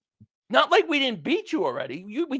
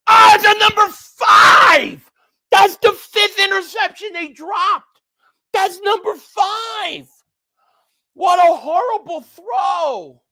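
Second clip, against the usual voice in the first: loud, high-pitched shout of disbelief; real exasperated sports-fan outburst; outraged yelling; 9 semitones higher, 9 dB louder, slower than usual. Real, not acted. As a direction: yell it out, stretching the big words. Reacting live to another dropped interception.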